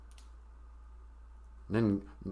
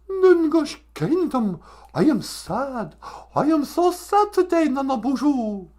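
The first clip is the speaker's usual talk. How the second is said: Greta Thunberg; 10 semitones higher than usual. Acted. Activist declares her sadness in Ojibwe, mock high wailing. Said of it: "Greta Thunberg".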